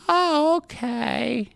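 high, drawn-out caricature voice; hard-of-hearing wife. Acted. Wife accepting husband's explanation, high comic caricature. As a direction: sing it out slowly, high up. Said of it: "the wife is hard of hearing".

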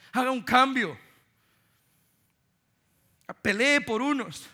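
loud, high, urgent pulpit voice; impassioned sermon appeal; preaching at full force. Acted. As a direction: drive the call home to the church. Fervent preaching, boy's note.

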